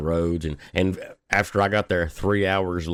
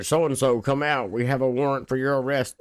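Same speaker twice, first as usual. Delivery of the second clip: higher, mock megaphone call; loudspeaker order; 7 semitones higher than usual. Police on the loudspeaker ordering the suspect out, mock megaphone voice. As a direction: bark it like a cop over a bullhorn.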